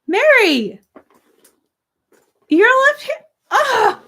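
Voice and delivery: high, loud delighted squeal; excited squeal. Real, not acted. Streamer squealing over another left-handed viewer. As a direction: shriek with joy at the news.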